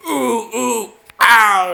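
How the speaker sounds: high cry of pain; mock pained yelp. Pretending pain at a silly game moment. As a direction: yelp as if hurt.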